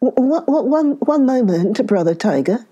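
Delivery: stammered, scared plea to the tiger; frightened begging. Acted. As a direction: stutter in fear, begging for time. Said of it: "begged the Brahmin".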